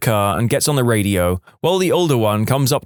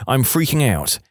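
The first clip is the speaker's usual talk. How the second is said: usual speaking voice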